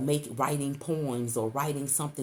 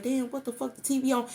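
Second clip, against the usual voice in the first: startled disbelief; 9 semitones higher, faster than usual. Retold or imitated.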